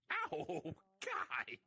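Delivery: high pained cry; pained yelp. Real, not acted. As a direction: yelp it in pain. Hit in game.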